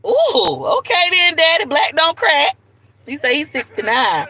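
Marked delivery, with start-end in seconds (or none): 0.1-2.6 high, louder whoop of surprise; impressed teasing. Real, not acted. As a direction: react with playful, high-pitched amazement. Reacting to the man's age.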